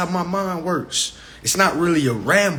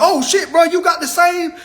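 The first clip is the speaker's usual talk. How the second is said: mock excited dude; 10 semitones higher, 3 dB louder than usual. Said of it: "Ain't no dude walking into his homeboy crib".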